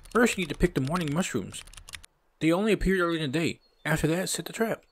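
high-pitched sidekick giving instructions; high put-on game character voice; Bob. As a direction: pitch it up for a helpful buddy.

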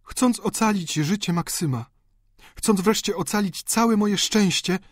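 emotional countess justifying herself; emboldened countess. Acted. Narrator voices the countess.

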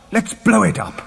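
high, eager exclamation; excited suggestion. A narrator's reading. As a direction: urge them on to the blast. Proposing to blast the rock.

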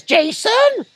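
high, loud shout of a name; calling out. Retold or imitated. Woman calling her tall friend back, loud high shout. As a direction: yell across the market for her mate.

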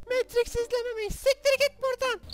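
very high squeaky mock rant; squeaky outraged voice. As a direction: shriek it in a cartoon voice. (cırtlak sinirli ses)

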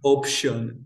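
Option with English sh sound; English pronunciation. Shown as a demonstration. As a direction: say Option the English way, shun ending. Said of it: "Opción".

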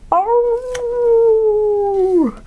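high loud drawn-out oh; a big storybook gasp; mock dismay. Lars seeing the puppy adrift, high loud gasp.

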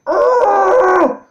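loud, high groan of rage; high-pitched shout of frustration; frustrated yell. Real, not acted. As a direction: yell a wordless, exasperated groan. Player just lost the battle.

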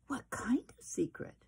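curious hushed whisper; whispered question. Acted. Chester asking his mother about the secret, hushed whisper.

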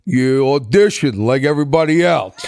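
lightly done Stallone, blunt and flat-out; a hint of Stallone shutting the kid down; a light Stallone brush-off as the doors close; Stallone impression. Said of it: "and he goes".

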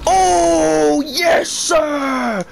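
hammed-up gamer yelling "yes, sir"; hype celebration. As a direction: shout it like a pro gamer hitting a trick shot. Celebrating a trick shot.

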